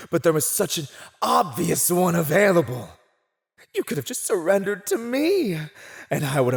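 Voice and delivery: high, loud, rushed outburst; exasperated villain. Acted. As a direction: burst out as if it's blindingly obvious.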